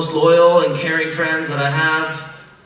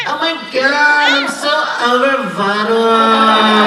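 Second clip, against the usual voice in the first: high, slow, sing-song exasperation; imitating Bavisha; 5 semitones higher, 5 dB louder, slower than usual. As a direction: drag it out like a fed-up bride. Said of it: "the last day I heard Bavisha say".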